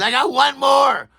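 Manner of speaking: high, loud announcement; a bright, high shout to the crew; calling out. Retold or imitated. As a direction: call ahead to the gate. Airport woman calling to gate staff.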